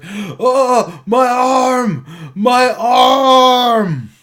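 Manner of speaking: high, loud wail of pain; screaming. Acted. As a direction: scream in agony over a lost arm, drawn out. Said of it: "he starts screaming".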